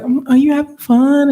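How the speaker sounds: high, light mom voice asking sweetly; imitating mom. Mom on phone, voiced in story.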